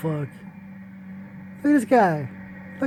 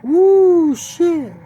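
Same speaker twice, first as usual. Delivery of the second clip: high, loud hype whoop; sudden thrilled cry; excited whoop; 10 semitones higher, 6 dB louder than usual. Real, not acted. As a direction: whoop at the hit like a fan. Reacting to a punch landing.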